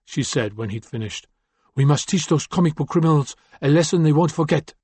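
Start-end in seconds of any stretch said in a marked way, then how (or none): 1.8-4.7 put-on light French accent, higher and cold; a hint of French in a stern woman's voice. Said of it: "with just a lingering trace of a French accent".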